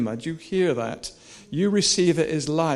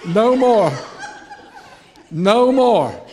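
loud, falling "no more"; defiant declaration; 3 semitones higher, 6 dB louder, slower than usual. Real, not acted. Preacher rallying the congregation to draw a line, defiant declaration. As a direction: declare it forcefully to the room.

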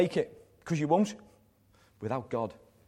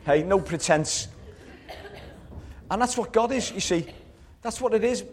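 laughing; 5 semitones higher than usual. Real, not acted. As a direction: chuckle through the words with joy. Delighted as kids come in.

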